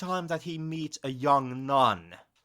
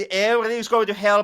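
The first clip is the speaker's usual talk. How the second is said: ordinary talk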